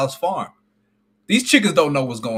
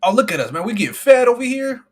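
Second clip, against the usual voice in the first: higher, gleeful chicken voice; happy fed chicken; 9 semitones higher, 3 dB louder than usual. Acted. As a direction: play the clueless chicken bragging about food.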